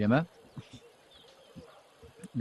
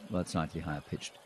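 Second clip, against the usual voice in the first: usual speaking voice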